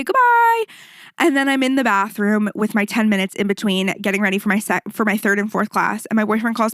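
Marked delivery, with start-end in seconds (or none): 0.0-0.6 very high, drawn-out goodbye; imitated chirpy instructor send-off; singsong farewell. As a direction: sing it out high, holding the last vowel. Retold class sign-off.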